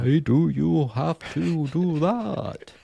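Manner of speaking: sing-song whale speak, long stretched-out vowels; whale accent. Pretend. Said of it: "That's my best whale accent".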